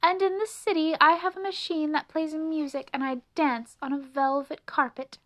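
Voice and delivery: grandly boasting, pitched high; lofty city mouse. A narrator's reading. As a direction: brag about city luxuries. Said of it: "said the city mouse rather grandly".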